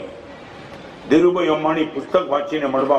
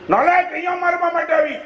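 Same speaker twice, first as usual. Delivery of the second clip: retold angry scream; 12 semitones higher than usual. Mother-in-law screaming at the daughter-in-law, high and angry. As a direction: shriek it in outrage. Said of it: "ચીસ પડી".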